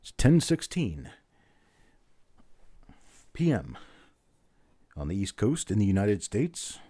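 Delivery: hammed-up radio newsreader intro with swooping pitch; mock news announcer. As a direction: play at announcing the news, spacing out phrases.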